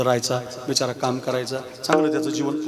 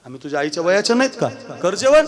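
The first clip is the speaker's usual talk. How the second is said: fast coaxing that climbs to a high, loud scold; hammed-up village aunties nagging him to eat; scolding old women; 4 semitones higher, 5 dB louder, faster than usual. Old village women scolding grieving Balya to eat, a rising loud scold.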